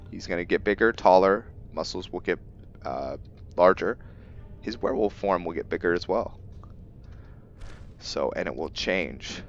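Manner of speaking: ordinary talk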